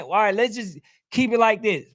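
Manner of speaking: normal talking voice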